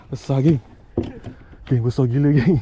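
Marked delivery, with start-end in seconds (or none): none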